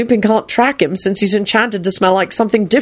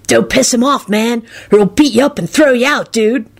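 lightly voiced dude, higher; urgent-ish warning, pitched up; 5 semitones higher than usual. A narrator's reading. Kenny warning everyone about Robbie, urgent.

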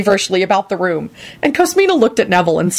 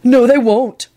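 higher-pitched, stubborn denial; 6 semitones higher, 3 dB louder, slower than usual. A narrator's reading. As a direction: dig in and contradict. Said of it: "Neville responded stubbornly".

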